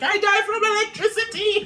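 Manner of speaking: high, loud comic exclamation; quoting a movie character. Retold or imitated. Film fan re-enacting a funny line, high and loud. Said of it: "and he's like".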